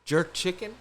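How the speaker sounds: a hint of a breezy party guest asking.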